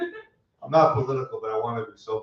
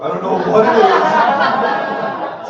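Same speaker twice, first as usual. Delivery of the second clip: laughing, drawn out; 11 semitones higher, 11 dB louder, much slower than usual. Real, not acted. Laughing punchline tag.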